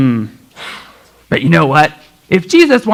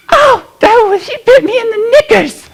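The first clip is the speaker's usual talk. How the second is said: high whiny complaint; faster than usual. Acted. Complaining after being bitten.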